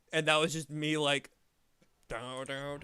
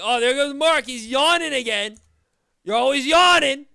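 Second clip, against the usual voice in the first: high, loud teasing boss voice; mocking boss; 11 semitones higher, 14 dB louder than usual. Boss calling out his yawning, loud high mockery. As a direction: ham up the boss ribbing him. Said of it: "my boss is like".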